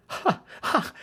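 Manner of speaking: breathy amused laugh; light laugh. A narrator's reading. Holmes laughing after the visitor leaves, light laugh. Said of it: "said Holmes, laughing".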